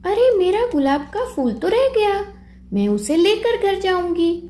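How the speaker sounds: lightly voiced girl, a touch startled; child Pinki.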